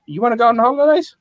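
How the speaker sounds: sing-song high question; bright fake-helpful clerk; high mock-cheery voice. Mock cheery question, much higher.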